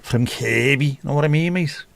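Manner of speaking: broad Scouse, rising high and quizzical; Scouse accent. Retold or imitated. A Scouser asking a mate if he's from Kirby, in broad Scouse. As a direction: put on a thick Kirby Scouser voice asking where you're from.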